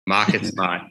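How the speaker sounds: put-on Marcus Smart in a Boston accent. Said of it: "you gotta pronounce his name in a Boston accent".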